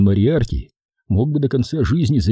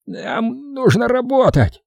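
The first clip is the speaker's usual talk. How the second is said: hoarse snap; 13 semitones higher, slower than usual. Acted. Breathless boss snaps.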